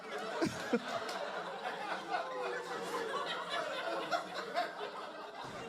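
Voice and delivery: airy giggle up high; wheezing laugh; high breathy laugh. Real, not acted. Comedian cracking up at his dad's joke, airy laugh.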